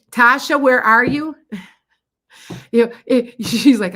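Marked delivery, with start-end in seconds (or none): 0.1-1.3 high, loud searching call. Real, not acted. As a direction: call out to find someone in the crowd. Calling out for the next viewer.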